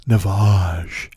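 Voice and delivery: low drawn-out product name; creepy ad voice. Retold or imitated. Mocking the Navage ad man, creepy low brand name. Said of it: "The way he says it is so creepy".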